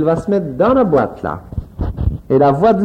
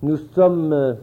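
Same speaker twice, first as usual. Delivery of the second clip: recording announcement; 8 semitones lower, 6 dB quieter, slower than usual. Acted. Dated announcement for the tape.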